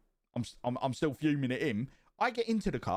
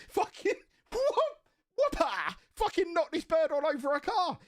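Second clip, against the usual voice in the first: much higher, gleeful retelling through laughter; laughing. Retold or imitated.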